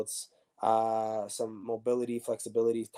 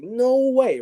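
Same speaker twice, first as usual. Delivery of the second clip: no way, jumping much higher and louder; excited disbelief; 13 semitones higher, 9 dB louder than usual. Real, not acted. Player recalling becoming Zlatan's teammate, excited disbelief. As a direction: burst out in thrilled disbelief.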